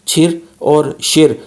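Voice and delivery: छिर और शिर slow with curled r; retroflex syllables. Shown as a demonstration. Teacher modelling retroflex syllables slowly. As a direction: draw out छिर and शिर, tongue back. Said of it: "पढ़ा जाता है".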